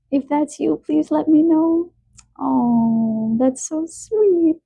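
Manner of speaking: high, touched coo; emotional. Real, not acted. Reader moved by viewer adopting a young dog, emotional. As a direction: voice lifts high, overcome by sweetness. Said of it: "I get really emotional about animals".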